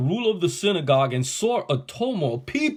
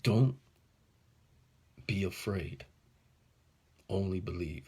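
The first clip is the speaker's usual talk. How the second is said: much softer, hushed and tender; quiet, comforting words; gentle reassurance; 7 semitones lower, 8 dB quieter than usual. Acted. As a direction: soothe a frightened father. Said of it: "what I believe Jesus was telling that guy".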